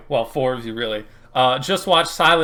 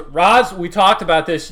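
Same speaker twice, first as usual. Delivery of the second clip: raised, louder reprimand; exasperated telling-off in character; scolding security guard; 5 semitones higher, 5 dB louder than usual. Acted. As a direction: scold her like a weary minder. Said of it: "And kinda pulls her".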